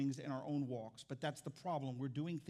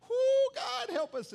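much higher, loud yelp to God; panicked cry; 9 dB louder, much slower than usual. Retold or imitated. Pastor reliving his cat panic, loud high cry to God. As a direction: cry out for help. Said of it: "I'm like".

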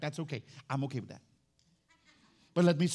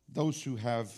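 ordinary talk

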